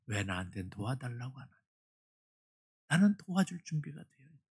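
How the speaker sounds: soft voice of the Lord repeated. Retold or imitated. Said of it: "주님이 그에게 이렇게 대답하시더래요".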